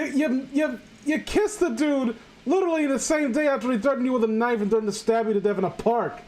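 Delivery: ordinary delivery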